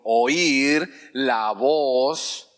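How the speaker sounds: oír la voz stretched very slowly; drawn-out call and response. Shown as a demonstration. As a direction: slowly draw out oír la voz for the church to repeat. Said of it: "diga conmigo".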